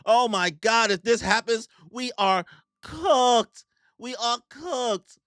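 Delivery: high, slow, drawn-out wail; mock panic. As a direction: moan it like doom is coming. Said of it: "they're freaking out".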